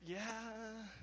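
high, drawn-out "yeah"; doubtful yeah. Acted. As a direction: stretch out a high, unconvinced yeah. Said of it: "in our hearts we say".

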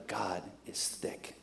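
breathed whisper, slow. Real, not acted. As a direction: let it sink in hushed. Sermon climax.

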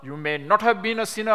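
put-on a hot-blooded young preacher scolding; militant retort. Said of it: "very militant posture".

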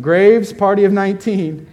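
loud announcement call. Retold or imitated. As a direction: call the party name across the room. Restaurant announcement calling out.

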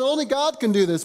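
a hint of offended religious scholars; indignant scribes. Scribes voiced protesting.

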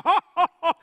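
jolly mock Santa belly laugh. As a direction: boom it out like Santa on his sleigh. Santa flying over Mishawaka.

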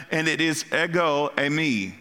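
ordinary talk, nothing marked

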